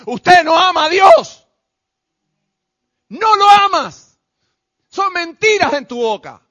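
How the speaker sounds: loud, slow, accusing shout; thundering rebuke. Real, not acted. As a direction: hammer it at the congregation. Preacher rebukes the congregation.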